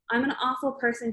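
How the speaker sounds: usual speaking voice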